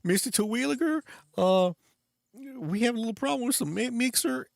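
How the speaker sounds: very high-pitched backwoods yokel voice. Retold or imitated. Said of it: "Cletus is like".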